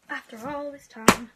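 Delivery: loud, slow excited cry; overwhelmed loud exclamation; excited outburst. Real, not acted. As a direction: burst out loud with joy. Emotional unboxing peak.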